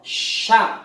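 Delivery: Sya with a breathy, unvoiced sh; hissed sya. Shown as a demonstration. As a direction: whisper the sh out. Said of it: "suaranya juga molor". (sya berdesis)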